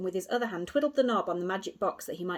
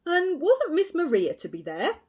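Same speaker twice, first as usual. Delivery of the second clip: loud, hearty, patronising policeman; a self-satisfied constable soothing a boy; pompous inspector; 10 semitones higher, 7 dB louder than usual. A narrator's reading. Inspector reassuring Kay with pompous bluster.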